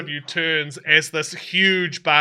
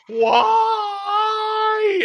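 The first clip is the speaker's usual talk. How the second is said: high, long-drawn cry; wailing badger; 4 dB louder than usual. Badger cries out as it gets swallowed, high wail. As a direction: wail the word out high like a doomed creature. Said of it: "badger sort of leans in and goes".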